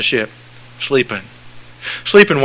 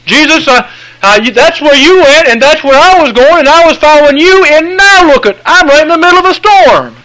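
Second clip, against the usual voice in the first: whiny complaining believer; 9 dB louder than usual. Acted. Whining believer complains to Jesus.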